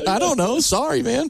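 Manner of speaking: high-pitched sheepish imitation; mock apology. Retelling two comedians doing a podcast, mock apology.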